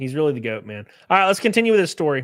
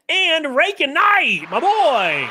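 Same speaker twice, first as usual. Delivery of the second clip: drawn-out higher and louder hype call; hype shout-out; 12 semitones higher, 5 dB louder, much slower than usual. Real, not acted. Streamer hyping a donor's name to chat, drawn-out and loud. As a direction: stretch the name like an announcer.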